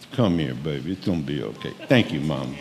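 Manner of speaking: mock soothing wife. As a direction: coo at him like a mother. Voices wife comforting him.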